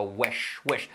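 "wesh" with short e and prolonged hush; exaggerated sh. Shown as a demonstration. Teacher demonstrates a very exaggerated sound, strong sh. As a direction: overdo the ending of "wesh". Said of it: "es un sonido muy exagerado".

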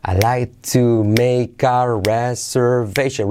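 stretched-out, syllable by syllable; slow model. Shown as a demonstration. Teacher before asking for repeats, slow model. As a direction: say it very slowly for learners to copy.

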